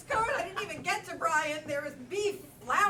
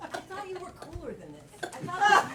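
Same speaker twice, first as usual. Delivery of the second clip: ordinary talk, nothing marked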